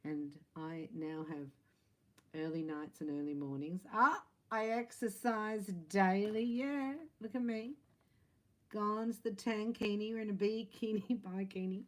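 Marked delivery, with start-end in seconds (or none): 3.9-11.2 strut it like you're parading a new bikini; playful boast. Pretend. Reader acting out the viewer's proud new self, mock show-off. 11.2-11.8 giggling repeat of bikini through a laugh. Real, not acted. Reader laughing at her own bikini joke, giggling.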